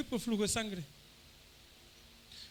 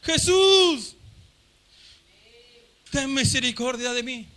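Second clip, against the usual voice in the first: louder shout; 3 semitones higher, 8 dB louder, slower than usual. Retold or imitated. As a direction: cry out with all his strength. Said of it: "él más fuerte gritaba". (grita más fuerte pidiendo misericordia)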